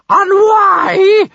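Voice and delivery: very high, very loud bellow; thundering foghorn shout. A narrator's reading. Bertha demands reasons from Kamikaze, thundering. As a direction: blast it out like a foghorn. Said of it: "in a foghorn voice so loud".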